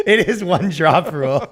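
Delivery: talking through laughter; laughing. Real, not acted. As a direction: agree while cracking up at the joke. Laughing at the other's joke.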